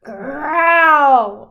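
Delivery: loud roaring bear voice. A narrator's reading. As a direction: let out a big storybook roar. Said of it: "Old Bear roared".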